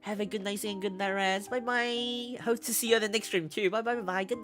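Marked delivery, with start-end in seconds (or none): none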